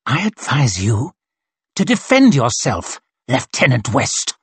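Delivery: restrained snarl in short bursts. A narrator's reading. Colonel warns the lieutenant to fight, restrained snarl. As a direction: snarl it with held-back threat. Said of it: "snarled Glocta".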